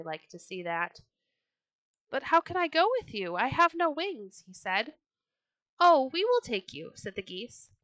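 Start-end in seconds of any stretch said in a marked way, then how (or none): 2.1-4.4 high, louder, quick storybook voice; eager protest. A narrator's reading. 5.8-6.9 high, loud storybook reassurance; eager offer. A narrator's reading. Geese eagerly offer a ride.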